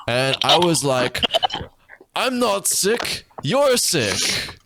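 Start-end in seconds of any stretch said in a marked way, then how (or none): 2.1-4.5 mock retort. Kid retorts in story.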